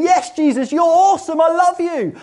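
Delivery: pretend heart's cry. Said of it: "your heart should be going".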